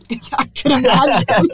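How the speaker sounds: amused, laughing reply. Real, not acted. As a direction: say it while cracking up. Amused at guest's offended story.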